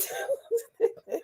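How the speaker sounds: a high squeaky giggle bursting out. Real, not acted. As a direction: giggle at yourself. Laughing at herself.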